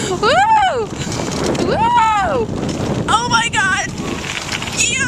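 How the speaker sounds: high whooping cheer; excited cheering. Real, not acted. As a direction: whoop with thrill. Thrilled riding downhill fast.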